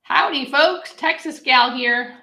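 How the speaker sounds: hammed-up Texas drawl on "Howdy, folks"; a playful cowgirl greeting, loud and bright; mock Texan accent. Greeting Texan viewers in a mock Texan accent. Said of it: "Very hard for me not to give you an Australian accent".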